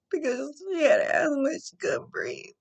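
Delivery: tearful, high and strained, fighting to get the words out; crying. Real, not acted. Mother recalling her daughter's asthma attack, crying. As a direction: voice breaking into tears while telling it.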